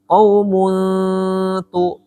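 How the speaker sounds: "Qaumun tu" with a long hum before TU; chanted "Qaumun tu"; held ikhfa nasal. Shown as a demonstration. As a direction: stretch the final n of "Qaumun", then release "tu". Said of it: "Bedanya apa cara bacanya".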